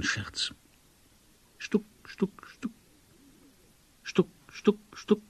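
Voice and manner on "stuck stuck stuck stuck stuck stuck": short isolated knock syllables; whispered knock-knock noise; knocking imitation. A narrator's reading. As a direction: imitate soft raps on wood. Narrator imitates the secret knocking.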